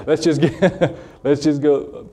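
southern accent broken up by laughter; amused southern accent, cracking up; laughing southern accent. Real, not acted. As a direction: laugh at your own southern accent while repeating yourself. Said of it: "I know I have a southern accent".

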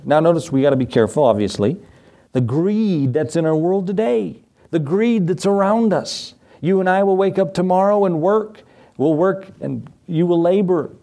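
ordinary talk

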